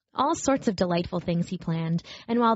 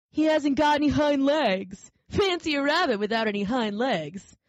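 mocking wild rabbit; 6 semitones higher than usual. A narrator's reading. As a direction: sneer at the odd rabbit. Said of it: "And he began to laugh".

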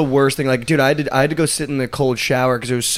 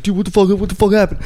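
higher-pitched, startled bystander imitation; kid behind him; 8 semitones higher than usual. Kid behind him in line asking about the game, imitated in a higher voice. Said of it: "This kid behind me is like".

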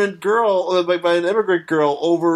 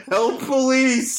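mock yell for help; 7 semitones higher, much slower than usual. Imitating a woman calling for police, mock yell. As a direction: call out like a comic damsel in distress. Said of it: "this woman's yelling for police".

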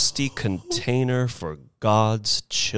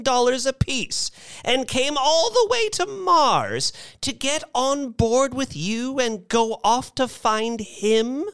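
put-on high, indignant old-lady voice. A narrator's reading. Missus Bellows questioning what they paid for, indignant. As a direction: play an appalled elderly woman, pitched high.

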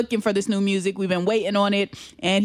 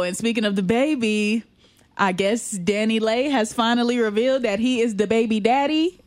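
usual speaking voice

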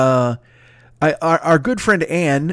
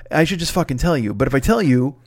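normal talking voice

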